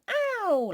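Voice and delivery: high pretend ow as if hurt; mock ow of pain. Shown as a demonstration. Teacher acting out hurting herself for the children, high mock yelp. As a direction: yelp a playful ow. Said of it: "Like when you hurt yourself".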